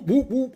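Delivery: high, playful whoop whoop; mock climbing whoops; rising whoop noises. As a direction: sound out a climb going up. Imitating going up the mountains.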